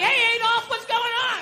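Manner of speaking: flirty high greeting. Retold or imitated. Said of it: "She's sitting there like".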